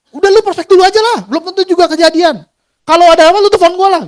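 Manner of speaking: strict leader telling him to stop asking, high voice; leader scolding. Retold or imitated. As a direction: wave off the questions, high and impatient. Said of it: "gua diomelin lu ya".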